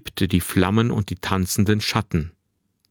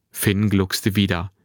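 usual speaking voice

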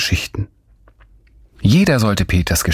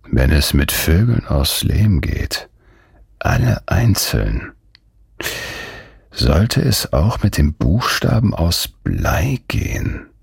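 slow, musing murmur; slow murmur, lost in an idea; slower than usual. A narrator's reading. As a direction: think it through aloud, very slowly. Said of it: "murmelte er".

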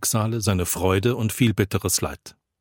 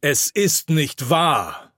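slow, raised-pitch protest over the din; indignant cry; 5 semitones higher, much slower than usual. A narrator's reading. Pastor gripped by anger protests into the noise, crying out. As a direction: cry out each word slowly, in anger. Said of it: "schreie ich nun in den Lärm".

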